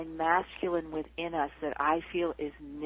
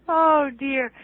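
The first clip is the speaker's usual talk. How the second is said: laughing; 7 semitones higher, 7 dB louder than usual. Real, not acted. Laughing at own answer.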